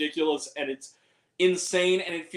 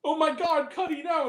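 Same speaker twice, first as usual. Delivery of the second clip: higher mock cry of alarm; retold cry; 11 semitones higher than usual. Retelling the death scene, mild mock alarm. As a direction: act out the movie's panic lightly. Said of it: "and he goes".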